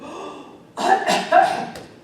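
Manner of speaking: loud, high, exaggerated gasp; disgusted gasp. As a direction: recoil noisily from a terrible stink. Bear sniffs the lion's feet.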